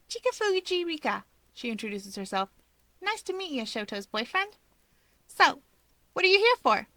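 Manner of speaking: high chirpy introduction; bright and cheeky greeting; sweet, teasing hello; perky Rika. A narrator's reading. Rika cheerfully introduces herself.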